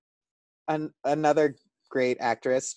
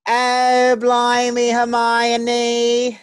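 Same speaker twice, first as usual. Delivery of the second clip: high, loud hammed-up British accent; a put-on posh British "blimey"; mock British accent; 8 semitones higher, 5 dB louder, much slower than usual.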